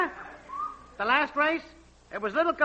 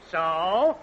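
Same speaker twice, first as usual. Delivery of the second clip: ordinary delivery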